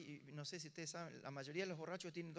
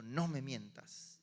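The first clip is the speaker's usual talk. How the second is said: put-on menacing drunk; 4 semitones lower, 6 dB louder, much slower than usual. Drunk man confronting the preacher.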